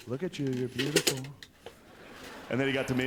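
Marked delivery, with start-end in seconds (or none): 0.1-1.2 a light hint of Trump; lightly voiced Trump compliment; Trump impression. Comic quoting Trump praising the guy next in line, lightly voiced. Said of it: "he was like".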